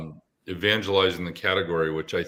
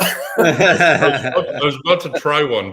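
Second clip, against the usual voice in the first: laughing; 8 semitones higher, 8 dB louder than usual. Real, not acted. Laughing at the Russian-accent request.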